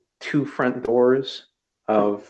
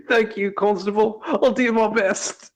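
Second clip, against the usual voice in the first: tearful grateful Lionel; 11 semitones higher than usual. A narrator's reading. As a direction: thank him through tears. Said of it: "He goes".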